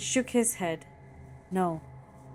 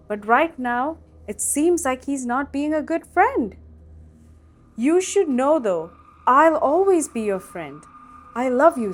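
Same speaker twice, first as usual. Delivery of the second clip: tender; 4 semitones higher, 4 dB louder than usual. Acted. Father comforting crying son.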